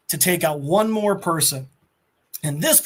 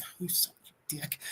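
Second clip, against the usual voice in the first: whispered insult; 17 dB quieter, much faster than usual. Retold or imitated. Ghost Rider mutters an insult.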